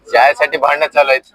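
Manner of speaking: normal talking voice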